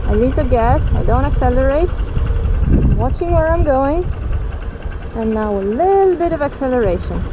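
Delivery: muffled voice in a helmet; talking inside a helmet on the bike; inside a motorcycle helmet. Real, not acted. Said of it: "You can hear me in, in the helmet".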